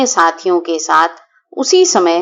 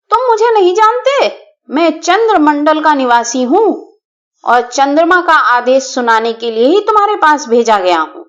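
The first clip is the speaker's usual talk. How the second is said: thundering voice; 4 semitones higher than usual. Acted. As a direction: thunder the moon's order at the elephant. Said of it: "Champak ने कड़कती आवाज में कहा".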